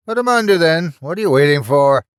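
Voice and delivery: high, loud impatient falsetto; falsetto villain. Acted. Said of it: "irritating falsetto voice".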